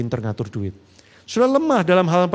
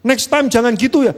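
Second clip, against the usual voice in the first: mock nagging; 8 semitones higher, 6 dB louder than usual. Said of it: "Malah saya omelin".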